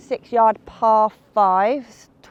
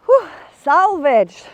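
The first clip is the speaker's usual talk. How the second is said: slow, loud sigh of relief; a big relieved exhale; 7 semitones higher, 9 dB louder, much slower than usual. Real, not acted. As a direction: let the relief out, slowly. Relief after risky putt.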